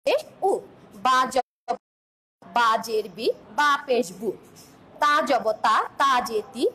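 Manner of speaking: teacher sounding letter, vowel mark and syllable in a measured chant; Arabic vowel marks modelled for a pupil; clear syllable drill. Shown as a demonstration. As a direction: spell out each harakat and land on the syllable. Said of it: "দেখো".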